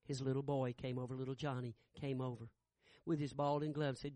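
normal talking voice

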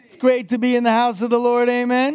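loud, high-pitched rallying call; rousing call. Real, not acted. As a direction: shout it out to get an amen back. Rallies the congregation for a response.

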